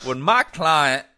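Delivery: high, very loud, slow Georgia gentleman drawl; Southern gentleman accent. Retold or imitated. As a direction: thunder it like an old Georgia lawyer in court. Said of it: "old Georgia Southern male accent".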